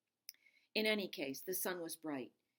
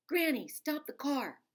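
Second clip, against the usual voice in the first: much higher, louder, slow plea; urgent demand; 9 semitones higher, 8 dB louder, slower than usual. Acted. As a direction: cry out for her to stop. Child demanding Granny stop.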